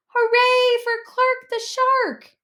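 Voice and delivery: high, loud, slow group cheer; cheering crowd. A narrator's reading. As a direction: cheer the hero shark. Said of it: "everyone cheered".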